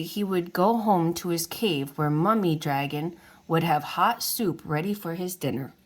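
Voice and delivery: plain reading voice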